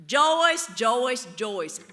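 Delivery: calm the angry principal down gently; soothing. Retold or imitated. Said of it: "And Betty says".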